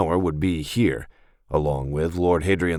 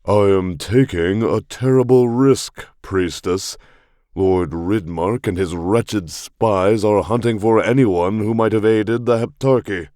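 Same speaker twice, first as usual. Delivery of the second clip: louder, higher, grandly pompous nobleman; commanding pompous lord; 3 semitones higher, 5 dB louder than usual. Acted. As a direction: proclaim it like a self-important lord. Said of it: "He had a commanding but pompous voice".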